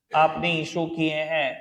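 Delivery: dictating phrase by phrase, long gaps; halting pace of writing along; slow dictation. Real, not acted. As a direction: space it out as you write. Teacher dictates while writing it out.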